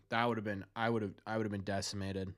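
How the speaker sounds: ordinary delivery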